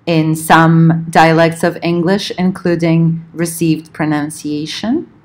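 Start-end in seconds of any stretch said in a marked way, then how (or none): none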